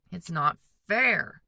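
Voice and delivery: slow, loud, sulky "it's not fair"; whiny complaint. A narrator's reading. Brother whining to his parents about chores. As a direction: drag it out like a sulking boy. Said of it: "Mark said again".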